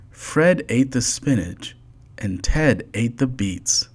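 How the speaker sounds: plain reading voice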